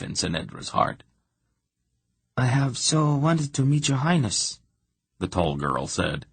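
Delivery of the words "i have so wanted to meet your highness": put-on a higher, lilting young lady's voice; vibrant musical voice. A narrator's reading. Tall girl greeting the princess, vibrant and musical. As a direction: sing the greeting out warmly. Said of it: "her voice vibrant and musical".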